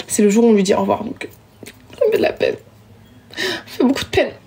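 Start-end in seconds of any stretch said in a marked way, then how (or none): 2.0-4.3 mock sadness. Farewell to returned phone.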